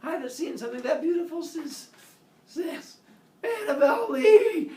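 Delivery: high, hammed-up voice cracking into a sob on "Annabel Lee"; teacher acting out the lover weeping at the moon; mock crying. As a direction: play the grieving lover, breaking down at her name. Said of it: "And he starts crying".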